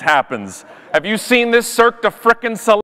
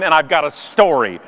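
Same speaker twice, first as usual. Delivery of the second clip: usual speaking voice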